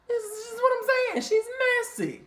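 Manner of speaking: very high put-on mocking voice; mocking the messy castmate. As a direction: mimic her in a high squeaky voice.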